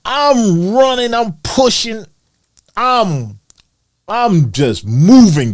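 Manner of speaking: excited sprint. Retold or imitated. Telling viewers how he took off, excited and drawn out. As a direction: relive the burst of speed.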